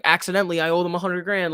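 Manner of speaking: high-pitched mock sheepish admission; mock panicked confession. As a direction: play up an embarrassing blunder, pitched high. Mock confession in imagined predicament.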